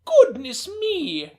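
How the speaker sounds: high, slow, shocked grandmother; old woman exclaiming in high, slow surprise. Acted. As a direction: gasp it like a startled nana. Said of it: "Emma Joe said".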